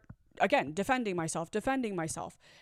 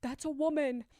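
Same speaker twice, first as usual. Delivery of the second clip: high-pitched imitation of alarmed men; lightly acted shouts of bystanders; mild retold yelling; 10 semitones higher than usual. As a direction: call out in protest, high but not full volume. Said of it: "some of the guys start yelling".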